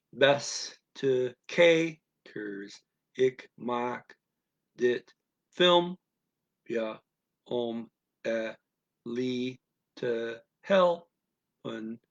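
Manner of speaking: very slow, each syllable separated by a pause. Shown as a demonstration. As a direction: dictate the Dutch one syllable at a time. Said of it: "read 'em one at a time".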